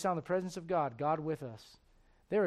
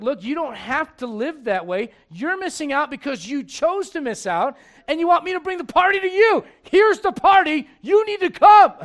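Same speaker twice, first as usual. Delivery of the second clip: emphatic appeal; 8 semitones higher, 13 dB louder than usual. Acted. Heavenly Father to those missing out, rising exasperated shout.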